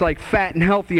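ordinary talk, nothing marked

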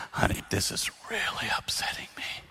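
breathy whisper to his wife. Retold or imitated. Husband calmly telling wife he's upset, whispered. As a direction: whisper the upset instead of yelling it. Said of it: "lower it to half your normal volume".

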